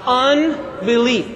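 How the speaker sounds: Unbelief stretched out syllable by syllable; very slow, deliberate Unbelief; slow for unison. Shown as a demonstration. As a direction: draw out Unbelief so a crowd can join. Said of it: "Let's all say that together".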